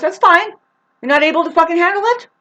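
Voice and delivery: high, loud taunting question; mock challenge.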